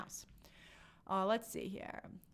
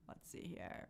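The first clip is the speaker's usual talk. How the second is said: whispered aside; 12 semitones lower, 18 dB quieter, slower than usual. Real, not acted. Scanning chat to herself.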